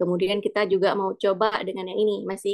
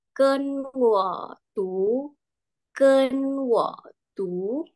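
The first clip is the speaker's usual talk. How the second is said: "Kenwotu" very slowly, syllable by syllable; slow model phrase; 4 semitones higher, much slower than usual. Shown as a demonstration. Teacher leading class to say it together, drawn out. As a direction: stretch every syllable of "kenwotu".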